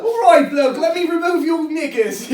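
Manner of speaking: high, loud hammed-up British accent. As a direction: shout it in a silly mock British bloke voice. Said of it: "he did a British one".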